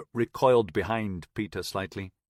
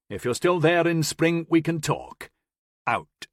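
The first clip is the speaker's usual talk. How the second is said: louder, firm radio orders; radio command; 6 semitones higher, 5 dB louder than usual. Acted. Man ordering the ship crew to hold, louder and firm. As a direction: give orders over the radio.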